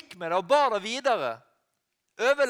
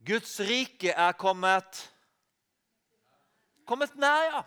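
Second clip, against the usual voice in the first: very slow, weighty, each word laid down; slow solemn quote; slower than usual. Acted. Preacher quoting Jesus to the congregation, slow and solemn. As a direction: draw out Guds rike with great weight. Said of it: "Jesus sa jo".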